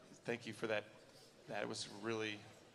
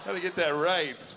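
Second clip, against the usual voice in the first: ordinary delivery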